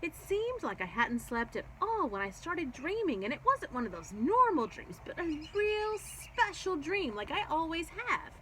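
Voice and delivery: babbling Gabby. A narrator's reading. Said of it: "she just babbled on".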